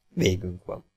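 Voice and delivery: soft, calm acceptance; said quietly. A narrator's reading. Outlaw calmly agreeing with the boy, quietly. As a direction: nod and say it quietly. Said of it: "bólintott csendesen".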